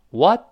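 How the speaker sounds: WHAT cut short with the t held back; unreleased t. Shown as a demonstration. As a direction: stop the air on the t of WHAT, no burst. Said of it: "空气不爆破".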